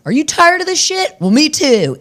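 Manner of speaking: high, loud rallying pitch, hammed up; mock rallying flyer. Slipping notes to the neighbours, a hammed-up high rallying pitch. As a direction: sell it like a cheesy ad.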